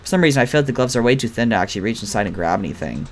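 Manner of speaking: normal talking voice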